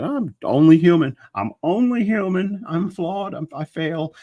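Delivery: high-pitched mock lament; hammed-up high voice of someone making excuses. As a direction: plead it in a put-on high voice. Mocks the culture's excuse trope.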